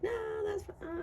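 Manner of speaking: high, soft, near-tearful; whiny brush-off. Retold or imitated. Card figure pretending it's fine, mock tearful. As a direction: whimper it like you're fine but not.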